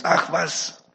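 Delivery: a ranting devil who keeps building; devil shouting. Acted. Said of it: "Dann schreit der Teufel raus".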